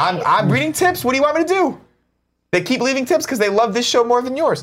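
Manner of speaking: high, indignant mock squabble; mock whiny protest. Host bickering with the rival show, mock whiny and high.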